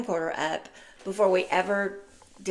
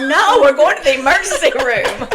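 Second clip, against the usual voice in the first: loud, higher-pitched firm refusal, half laughing; insistent; 7 semitones higher, 11 dB louder than usual. Retold or imitated. Mother overruling a child who only wants a Band-Aid, loudly insistent.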